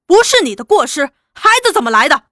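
loud, fast, scornful comeback; angry retort. Acted. Laoliu angrily throwing her excuse back at her. Husband raising fist at wife.

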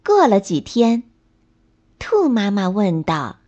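usual speaking voice

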